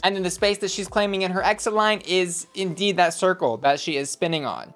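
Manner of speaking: ordinary talk, nothing marked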